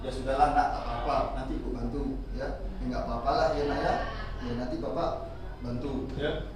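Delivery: low, soft, coaxing reassurance to a pupil; soothing teacher. Retold or imitated. (suara guru yang lembut menenangkan)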